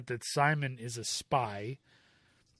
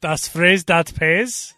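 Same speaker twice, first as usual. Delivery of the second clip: slow, louder mock Austrian German accent; 10 dB louder, slower than usual. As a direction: bark it like an Austrian villain. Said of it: "is Austrian German".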